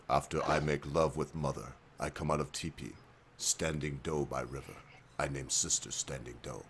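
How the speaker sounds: put-on old chief father. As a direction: drop the voice way down and speak like a grave old chief. Father answers again in joke.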